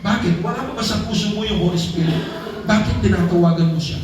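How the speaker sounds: usual speaking voice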